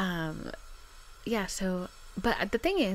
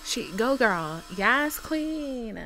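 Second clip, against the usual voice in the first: cheering; 6 semitones higher, 8 dB louder, much slower than usual. Real, not acted. Cheering someone on, louder.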